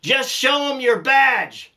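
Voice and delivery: high, slow call across a field; yelling across the field. Retold or imitated. Rancher yelling to the inspector chased by the bull, high and slow. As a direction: shout the advice out to him. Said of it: "So I yelled out at him".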